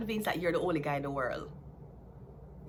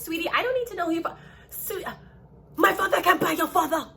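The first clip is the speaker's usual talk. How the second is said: put-on high, loud bratty taunt from a spoiled rich boy; shrill-pitched mock of a rich boy showing off; spoiled rich kid; 9 semitones higher, 6 dB louder than usual. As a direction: sneer it like a rich brat bragging about daddy. Mocking a spoiled rich rival.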